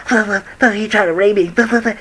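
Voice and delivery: high mocking girly blah blah; mock a typical girl, way up high. Imitating the friend passing by, high mocking girl voice. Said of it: "how typical girls".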